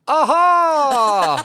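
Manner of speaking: loud, high triumphant aha; mock Harry Enfield cry. Fan greeting Harry Enfield, loud mock shout. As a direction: bark it out like a catchphrase. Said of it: "I would have said".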